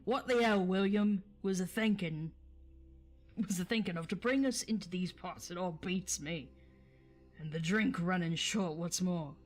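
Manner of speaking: gruff Cockney troll grumbling about mutton; a troll moaning in broad Cockney; troll Cockney accent. Acted. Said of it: "how can I not do an accent".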